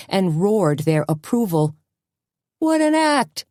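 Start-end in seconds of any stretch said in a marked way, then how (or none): 2.6-3.4 high delighted yell; crowd yell. A narrator's reading. Someone in the crowd cheering the act, high delighted yell. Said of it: "someone yelled".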